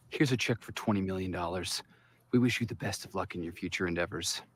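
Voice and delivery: cold and detached. Acted. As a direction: deliver the firing coldly. Cold boss firing protege.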